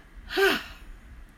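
high breathy sigh, pitch falling; loud emphatic sigh. Shown as a demonstration. Teacher's last shoulder drop with a big sigh. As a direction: push the air out in one big sigh. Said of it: "a loud, emphatic sigh on the out-breath".

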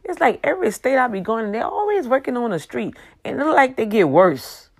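high, fast exasperated rant; a high, rapid roadwork complaint; worked-up rant. Real, not acted. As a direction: rattle it off, fed up with the roads. Ranting about endless road construction.

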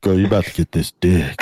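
quoting it in a deep low voice; deep bass voice. Retold or imitated. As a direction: drop low for the quote. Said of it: "quoting the clip in low voice".

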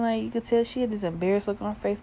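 usual speaking voice

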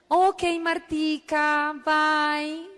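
slow, drawn-out phone goodbye; singsong sign-off. Retold or imitated. Recruiter hangs up on Martica, slow singsong. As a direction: stretch each word into a sung farewell.

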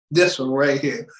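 put-on low, picking out a kid; a hint of a stern official pointing; mock official. Host acting out the judge choosing, low voice.

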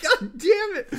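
high, loud giggling fit; bubbly laughter. Acted. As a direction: laugh like a giddy grown man. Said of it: "very almost bubbly laughter".

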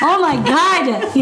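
slow, laughing high squeal; laughing shriek. Real, not acted. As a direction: burst out laughing at the question. Laughing at question.

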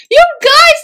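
high, loud mock wail of betrayal; hammed-up upset fan cry. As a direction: whine like a betrayed fan, half laughing. Imitating betrayed upset fans.

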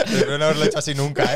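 fast, laughing through the words; giggling while talking. Real, not acted. Laughing at the memory.

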